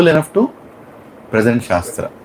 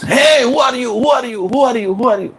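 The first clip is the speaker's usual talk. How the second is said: shouting; 11 semitones higher than usual. Retold or imitated. Guru catching a stranger in the dark, shouting high. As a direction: call out sharply into the dark to a stranger. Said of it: "And Lokanath Swami shouts".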